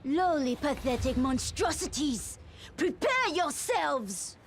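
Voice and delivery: high, imperious battle challenge; menacing villain. Acted. Villain to despised enemies before attacking, scornful challenge.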